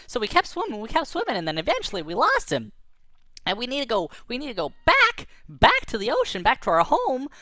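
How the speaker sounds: high, squeaky little fish chatter; squeaky worried anchovies; tiny anchovy voice. Acted. Anchovies tell their story.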